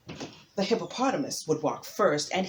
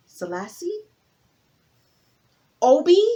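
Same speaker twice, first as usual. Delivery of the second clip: high, loud hopeful guesses; eager guessing guests; 8 semitones higher, 9 dB louder than usual. Acted. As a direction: call out each name hoping it's right.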